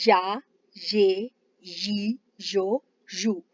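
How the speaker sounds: या ये यी यो यू said very slowly; slow drill of या ये यी यो यू; syllable drill. Shown as a demonstration. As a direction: draw out each y syllable. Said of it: "इसकी आवाज़ है".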